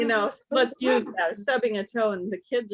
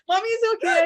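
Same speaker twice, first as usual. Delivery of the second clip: mock frazzled mom; 13 semitones higher, 6 dB louder than usual.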